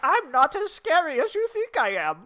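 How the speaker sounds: playful Zant voice. Pretend. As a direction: squeak it like a harmless villain. Voicing Zant after greeting him.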